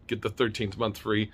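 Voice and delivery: ordinary delivery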